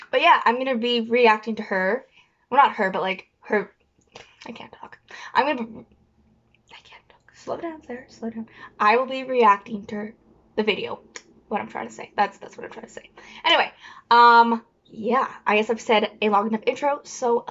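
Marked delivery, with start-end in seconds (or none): 4.4-8.5 whispering. Real, not acted. Flustered self-correction, whispered. 11.5-13.1 whispering. Real, not acted. Muttered self-correction.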